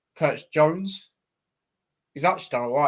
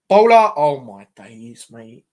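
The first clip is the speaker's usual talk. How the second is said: exasperated groan; 3 semitones lower, 11 dB louder, slower than usual. Real, not acted. Exasperated at a defensive error.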